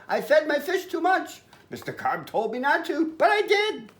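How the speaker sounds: put-on high-pitched, worried boy; panicky confession.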